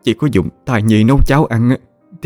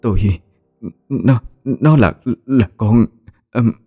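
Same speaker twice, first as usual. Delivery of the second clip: higher, slow halting stammer; terrified, can't bring herself to say it; a wife caught out, stammering in fear; panicked; 4 semitones higher, slower than usual. A narrator's reading. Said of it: "ánh mắt hoảng loạn".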